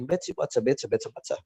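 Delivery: British crisp t's in the tongue twister; British accent, every t sounded hard. Shown as a demonstration. Teacher showing how British people say it, British accent. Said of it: "Kalau orang British bilang".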